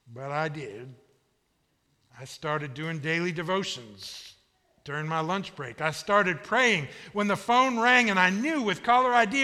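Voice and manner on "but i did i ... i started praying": usual speaking voice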